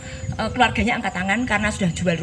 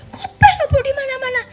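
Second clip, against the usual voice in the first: very high, loud cry of grief; wife shouting in heartbreak; anguished shout; 7 dB louder than usual. Retold or imitated. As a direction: shriek the accusation through tears. Wife repeats, crying out louder.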